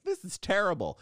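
high-pitched mock indignation; mock outrage. Mimics outraged people on social media.

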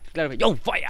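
a light show-off voice on "John Fire"; showing off, fronting. Shown as a demonstration. Co-host showing how the donor fronts, lightly voiced swagger. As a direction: say "John Fire" like a cocky poser. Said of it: "yo siento que frontea así".

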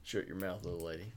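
badly faked country accent, quiet and low. Joking back at his critic, country voice. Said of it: "throw in my country voice".

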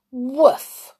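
a dog's loud, high scornful woof; Timmy scoffs at being thought afraid, scornful woof. A narrator's reading. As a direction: bark it with disdain. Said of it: "said Timmy scornfully".

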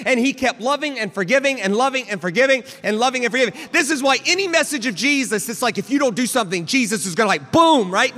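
high, loud preacher's proclamation; impassioned preaching. Real, not acted. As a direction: build into full-force preaching. Preaches at full force.

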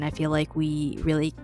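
usual speaking voice

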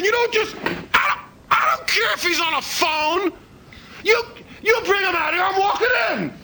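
high-pitched, full-throated angry shout; furious high-pitched tirade; enraged yelling. Acted. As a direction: storm in and yell demands at whoever's in charge. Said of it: "there's only one guy you're yelling at".

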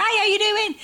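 high, bright, friendly local lady; a neighbourly stranger striking up chat; chatty Hull woman. Retold or imitated. Imitating a friendly Hull woman greeting her, high and bright.

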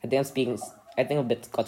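put-on half-sure Scottish accent, low; attempted Scottish accent. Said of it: "I'm trying a Scottish accent".

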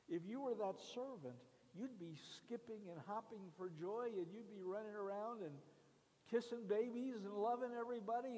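excited preaching. Acted. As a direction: paint the joy with energy. Excited sermon build.

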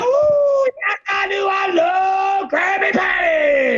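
high, slow cartoon moan; Squidward craving. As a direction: whine it like a starving squid.

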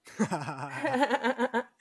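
sudden burst of laughter; high laugh. Real, not acted. Laughs at failed robot attempt.

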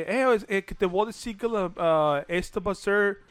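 gringo speaking Spanish; imitating an American speaking Spanish; American accent Spanish. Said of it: "hablaba bien raro de".

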